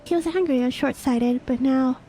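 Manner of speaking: put-on a young squire explaining gently; young companion voice. Companion recounting Sid's past.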